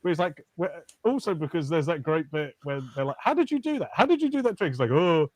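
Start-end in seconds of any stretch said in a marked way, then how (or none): 3.2-4.7 high, eager questioning; mock amazement. Onlookers quizzing the mantis magician about his trick, mock amazement. 4.9-5.3 high, theatrical magician's voice; old mystic mantis. Retold or imitated. Manny coyly refusing onlookers the secret, old mystic voice. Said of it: "this weird old mystic praying mantis".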